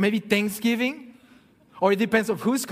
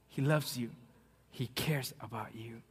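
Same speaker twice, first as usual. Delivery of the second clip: whisper; 9 semitones lower, 12 dB quieter than usual. Real, not acted. Preacher drops to a whisper.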